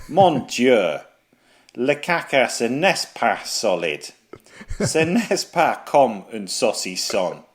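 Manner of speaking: hammed-up French letter reading in a French accent; reading a listener's letter as a pompous Frenchman, French accent; fake French accent. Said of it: "put on that accent".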